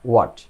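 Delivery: হোয়াট said as "wat", no h; correct pronunciation. Shown as a demonstration. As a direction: start হোয়াট straight on the w, one short syllable. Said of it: "এটা সঠিক উচ্চারণ হচ্ছে".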